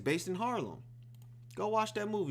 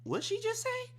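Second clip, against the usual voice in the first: very high squeaky question; stunned disbelief. Retold or imitated. Viewer reacting to the daughter's warning, high and stunned. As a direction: gasp it out shocked. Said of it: "I was like".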